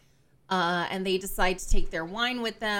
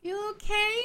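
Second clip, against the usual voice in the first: acted concern; 13 semitones higher than usual. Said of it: "she's like".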